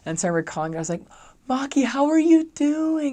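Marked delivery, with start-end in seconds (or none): 1.5-3.1 high, loud, stretched-out greeting; over-sweet high phone voice; kindly high voice. Retold or imitated. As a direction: greet them gushingly, voice sent way up. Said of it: "my voice goes up".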